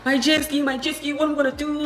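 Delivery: high, whiny mock lament; imitating upset owner. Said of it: "He was pissed".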